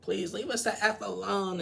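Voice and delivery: mimicked exasperated snapping; Rebecca. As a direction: act out snapping at an interfering friend. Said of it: "Rebecca snaps at Shekinah".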